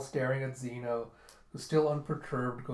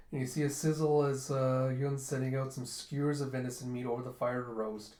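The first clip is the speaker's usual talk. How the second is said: ordinary delivery, nothing marked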